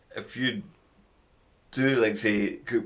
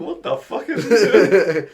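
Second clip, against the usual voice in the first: slow, high, louder disbelief through laughter; incredulous dad voice; 10 semitones higher, 8 dB louder, slower than usual. Pretend. Co-host imagines Tom Hanks's baffled reaction, slow and incredulous. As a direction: play the baffled famous father. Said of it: "imagine Woody standing there saying".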